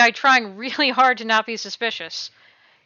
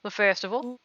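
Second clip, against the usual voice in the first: ordinary delivery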